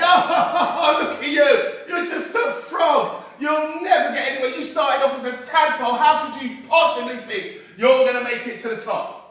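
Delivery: high, loud, laughing taunt; a crowd cackling at a frog's ambition; mocking laughter. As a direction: laugh at him as you jeer. Crowd mocking the second frog.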